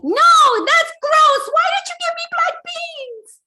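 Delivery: very high, loud bratty whine; whiny sassy kid. Retold or imitated. Child complaining about dinner, very high and bratty. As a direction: squeal it like a picky child. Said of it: "when they answer back like".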